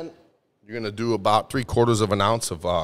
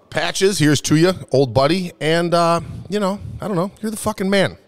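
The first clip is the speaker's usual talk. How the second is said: raised toast; 7 semitones higher than usual. Real, not acted. Toast to Patches.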